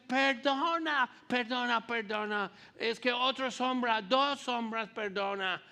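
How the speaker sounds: hammed-up American lighting guy; American accent. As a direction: apologize fast in a thick American accent. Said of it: "y con mucho acento".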